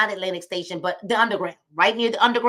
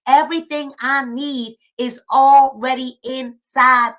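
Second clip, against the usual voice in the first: slow, louder, leaning on already; affirmation said loud and slow; 2 semitones higher, 7 dB louder, slower than usual. Shown as a demonstration. Host leading viewers in an affirmation, said loud and slow. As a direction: declare it to yourself, building. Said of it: "and say it loud".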